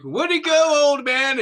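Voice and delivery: put-on high, loud cheer from the kids; cheering crowd. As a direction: shout it up high like a fan. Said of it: "They're like".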